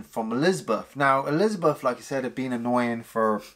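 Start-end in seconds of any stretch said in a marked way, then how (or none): none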